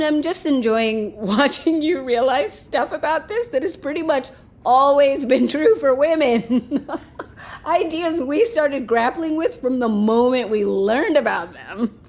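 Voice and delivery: giggling through the words, barely holding it together; laughing while talking. Real, not acted. Amused friend laughing at his panic about the pregnancy, words bubbling with laughter. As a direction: let real laughter break into each phrase. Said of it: "Are you laughing at me?".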